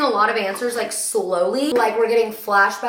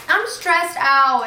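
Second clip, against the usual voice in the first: stressed; 6 semitones higher, 4 dB louder, much slower than usual. Real, not acted. Anxious about the book.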